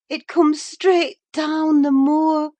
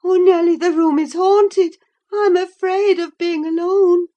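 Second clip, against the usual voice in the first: high, loud, frightened voice; fearful of the shape in the glass; anxious; 13 semitones higher, 10 dB louder than usual. A narrator's reading. Catherine afraid of a face in the mirror, high and frightened. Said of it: "she pursued anxiously".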